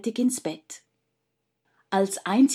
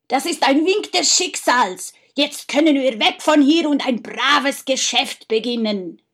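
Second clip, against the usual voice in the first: higher, louder gleeful rejoicing; exultant; 5 semitones higher, 5 dB louder than usual. Acted. Brother finding the count's leather purse, exultant cry. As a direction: crow with glee at your luck. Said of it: "frohlockte er".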